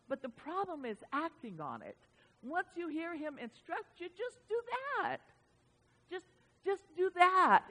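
usual speaking voice

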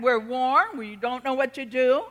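usual speaking voice

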